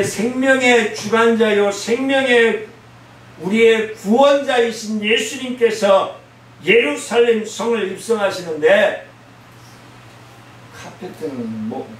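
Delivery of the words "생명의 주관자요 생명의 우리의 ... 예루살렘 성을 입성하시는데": lightly raised preaching. Acted. Preaching at full force.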